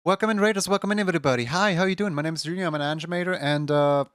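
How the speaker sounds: high, sweet streamer voice; bubbly high greeting voice; cute anime streamer. Acted.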